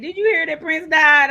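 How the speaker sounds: put-on a receptionist's island accent, bursting with the news; a hint of a Caribbean island accent. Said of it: "the woman in her island accent".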